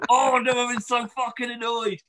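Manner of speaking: high, half-laughing wail; laugh-crying frustration. Real, not acted. Venting to the cohost, laughing and wailing.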